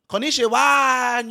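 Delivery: slow, high, loud "Konnichiwa" in a put-on Japanese accent; mock Japanese accent. Parody candidate pandering with a mock Japanese accent. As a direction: greet the crowd in a hammed-up Japanese accent. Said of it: "these different accents".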